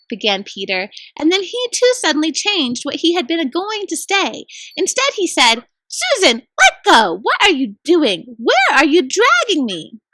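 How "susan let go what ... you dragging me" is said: alarmed. Acted. Peter being dragged by magic.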